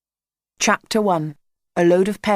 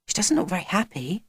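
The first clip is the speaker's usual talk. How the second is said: murmur; 6 dB quieter than usual. A narrator's reading. Lizzie to Jack as they approach, murmuring. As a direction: murmur it with quiet concern. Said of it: "murmured Lizzie".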